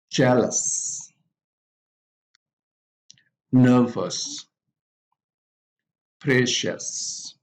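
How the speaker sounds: FA-mous, CON-scious, JEA-lous, NER-vous, PRE-cious, each stressed at the front; first-syllable stress. Shown as a demonstration. Teacher models adjectives with a suffix, first-syllable stress on famous, conscious, jealous, nervous, precious. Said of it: "also have the stress or strong stress".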